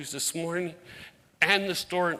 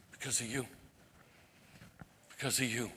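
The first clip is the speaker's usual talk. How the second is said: soft, lowered, sincere voice; heartfelt gratitude; 4 semitones lower, 10 dB quieter, faster than usual. Real, not acted. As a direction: let it land gently and tenderly. Sincere thanks to the congregation.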